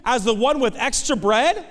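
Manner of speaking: high-pitched incredulous question; acted astonishment. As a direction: question God in loud, high disbelief.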